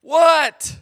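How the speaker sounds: loud, high-pitched shouted reply; yelling back. Retold or imitated. Lost child answering dad in the woods, yelling. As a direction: holler it back across a distance. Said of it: "I said the only thing ... and I go".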